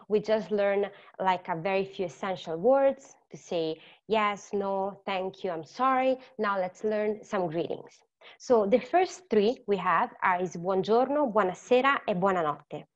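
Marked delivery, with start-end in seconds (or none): none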